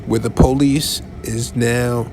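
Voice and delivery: ordinary delivery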